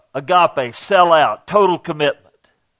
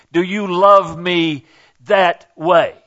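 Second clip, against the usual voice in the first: slow emphasis; slower than usual. Real, not acted.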